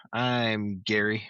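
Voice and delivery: normal talking voice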